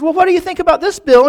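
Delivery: high mock-angry taunt; acted-out incensed biker. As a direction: hurl the challenge, loudest on the name. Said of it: "this incensed the man".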